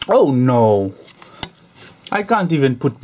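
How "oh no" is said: ordinary talk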